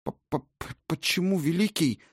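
high, stuttering, frightened question; stammering fearfully. A narrator's reading. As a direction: stammer out in fear. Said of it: "пролепетала дочь Одина".

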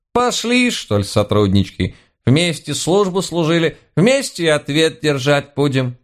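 high-pitched granny voice; rallying old housekeeper. A narrator's reading. Housekeeper rallies her police colleagues to face judgement, high voice. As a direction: urge them along in a high old-woman voice.

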